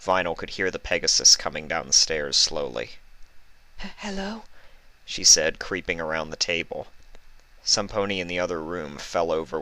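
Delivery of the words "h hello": high, soft stammered hello; timid hello. Acted. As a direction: creep forward and ask, scared. Fluttershy voiced in story.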